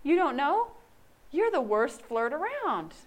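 a hint of a smug guy friend; teasing friend. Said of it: "He's like".

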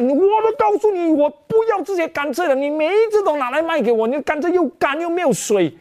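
high, shrill-pitched retold tirade; angry scolding. Vendor scolding old woman over dry sugarcane, angry high voice. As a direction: scold her like an irritated vendor.

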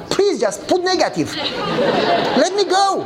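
high, half-laughing plea; mock pleading. Pastor begging the officer to deny him, mock pleading.

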